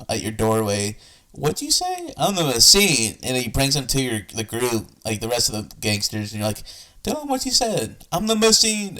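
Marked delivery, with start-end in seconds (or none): 1.3-2.1 high-pitched mimicked question; playful high-voiced re-enactment; imitated question. Listener re-enacts the gangster's question. 2.2-3.2 loud, drawn-out mock declaration; playful raised re-enactment; mimicked shout. Listener mimics the comedian's shouted line. 7.0-9.0 high-pitched playful re-enactment; mock declaration pitched way up; imitated exchange. Listener re-enacts gangster and comedian exchange.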